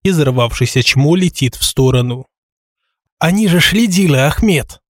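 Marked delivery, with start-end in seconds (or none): 3.2-4.8 high whiny lisping complaint; whining lisp. Acted. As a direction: whimper it out, offended. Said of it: "Обиженно проскулила".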